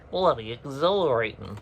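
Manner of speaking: playful mock Australian accent. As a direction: toss it off in a hammy Australian accent. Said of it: "I don't know why I made it Australian".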